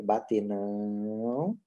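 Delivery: low, soft "Bater não" with a long-held "não"; very slow, restrained command; very drawn-out correction. Shown as a demonstration. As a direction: stretch "não" right out, low and steady. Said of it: "segura mais tempo".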